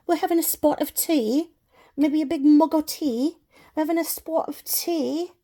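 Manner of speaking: hint of a British accent; mock British. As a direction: play at being across the pond over tea. Imitating British tea talk.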